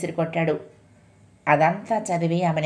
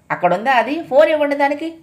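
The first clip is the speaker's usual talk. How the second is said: higher and louder furious demand; angry shout; 8 semitones higher, 5 dB louder than usual. A narrator's reading. A furious father demands the inspector hand the phone to his daughter. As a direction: bark it out in rage. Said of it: "కోపంతో అరిచాడు".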